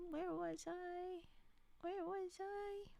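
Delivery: slow, drawn-out musing; slow dreamy where-was-I; sing-song wondering. Acted. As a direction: drag out each word as if lost. Losing her place in the book.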